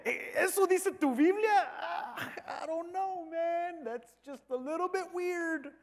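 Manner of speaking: high, put-on doubtful voice; mocking sceptic. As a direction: act out a scoffer squirming with doubt. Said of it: "burlándose de lo que Dios le pidió". (escéptico burlón, con voz aguda)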